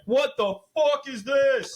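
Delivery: very high, slow, squeaky cartoon outrage; stomach's outraged voice. The stomach reacting to alcohol in a very high, slow squeal. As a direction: voice the stomach as a shocked little creature. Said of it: "it's like".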